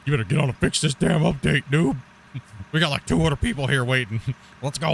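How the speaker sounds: impatient telling-off, played for laughs; mock scolding. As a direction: playfully hurry up a slacking developer. Retold scolding of the developer.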